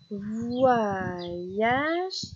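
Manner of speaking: "Voyage" stretched long, gliding up to "yage"; syllables drawn out. Shown as a demonstration. Teacher helping a child spot the last syllable, "Voyage" stretched. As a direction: draw "Voyage" out as voi... yage. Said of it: "repérer ces dernières syllabes".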